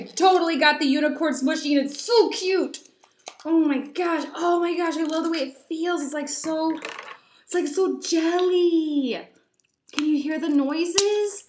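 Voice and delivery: high, loud gushing squeals; too excited. Real, not acted. Squishing her new unicorn toy for viewers, overexcited gushing. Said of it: "I just got way too excited".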